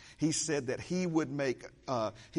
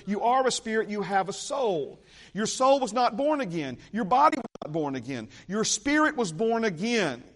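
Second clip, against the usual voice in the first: preaching at full force; 4 semitones higher, 4 dB louder than usual. Real, not acted. Preacher building to climax.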